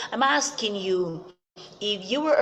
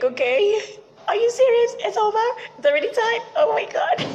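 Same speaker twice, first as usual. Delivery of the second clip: much higher and louder, squealing protest; shocked disbelief; 14 semitones higher, 5 dB louder than usual. Pretend. Speaker acting out a viewer told the show ended, high squealing dismay. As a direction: squeal in mock dismay that it's over. Imagined dismay, program is over.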